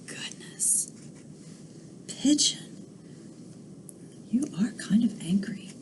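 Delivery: soft whisper to the listeners; hushed storyteller aside. Acted. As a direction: lean in and whisper a secret comment.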